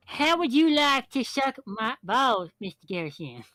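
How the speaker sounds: loud nasal cartoon kid voice; Cartman impression. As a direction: shout it like a bratty cartoon kid. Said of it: "Actually, what I said was".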